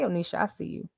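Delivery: ordinary talk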